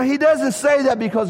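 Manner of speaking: ordinary talk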